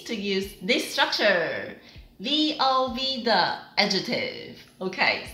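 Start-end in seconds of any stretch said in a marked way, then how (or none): none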